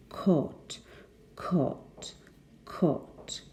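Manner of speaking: "caught" in careful RP, long aw vowel; deliberate, model "caught" with a long vowel; best received pronunciation. Shown as a demonstration. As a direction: say "caught" exactly like "court" without an R. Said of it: "I'll do my best".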